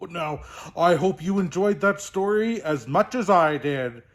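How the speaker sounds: big, merry Santa voice; jolly Santa host. Acted. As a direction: bid listeners back as a booming Santa. Santa signs off.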